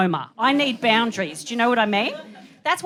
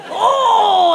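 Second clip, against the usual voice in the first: loud, high, drawn-out "oh"; outraged howl; 12 semitones higher, 10 dB louder than usual. Acted. Mum reacting to son's reply, loud high outraged howl. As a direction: wail it high in comic outrage.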